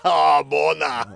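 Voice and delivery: slow mock Schwarzenegger-accent moan; Arnold Schwarzenegger accent. Co-host demonstrating his accent idea, mock Schwarzenegger accent. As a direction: moan it with a hammed-up Austrian accent. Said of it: "like, an Arnold Schwarzenegger accent".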